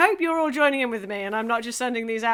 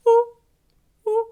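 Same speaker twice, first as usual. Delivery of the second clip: owl hoot; 10 semitones higher, 9 dB louder than usual. Shown as a demonstration. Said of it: "So I'm gonna owl".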